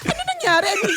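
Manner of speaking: high, flustered what happened. Retold or imitated. Wife flustered by husband's panic, high alarmed question. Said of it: "nataranta din ako".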